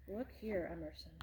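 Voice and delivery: soft aside to the little listener, dropping to a whisper; hushed aside. Real, not acted.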